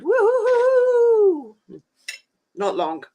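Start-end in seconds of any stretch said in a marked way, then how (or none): 0.0-1.6 excited cheer. Real, not acted. Cheering that the weekend is near.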